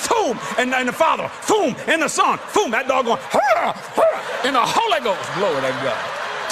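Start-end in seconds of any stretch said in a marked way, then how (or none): 3.3-5.3 very high, slow dog howl; mock dog baying; dog noise. Dog voiced in the story. 5.3-6.5 preaching exclamation. Acted. Preacher exclaims during the tale.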